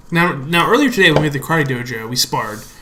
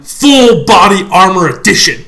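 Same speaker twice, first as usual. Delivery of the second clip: slow, louder ring-announcer billing; bill the matchup like a boxing event; mock announcer voice; fight announcer; 3 semitones higher, 5 dB louder, slower than usual. Announcing a fight episode title.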